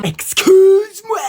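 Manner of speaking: high, drawn-out offended outcry; affronted. Acted. Parent hears the children complain, affronted. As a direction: stretch it out in mock outrage.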